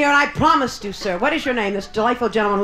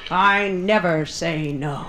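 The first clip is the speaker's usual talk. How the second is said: slow punchline; 7 semitones lower, much slower than usual. Acted. Punchline, slowed.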